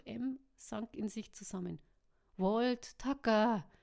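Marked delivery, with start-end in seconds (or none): 2.4-3.7 loud, higher, tearful call; crying out. A narrator's reading. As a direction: cry out the names. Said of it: "herrschte sie weinend".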